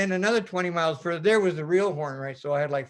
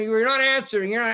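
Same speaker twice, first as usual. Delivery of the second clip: scolding officer; 5 semitones higher, 5 dB louder than usual. Retold or imitated. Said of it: "They're getting".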